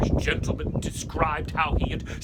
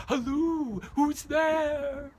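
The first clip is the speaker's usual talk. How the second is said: calling out; 12 semitones higher, much slower than usual. Acted. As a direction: call out nervously to the stranger. Hailing an unknown rider.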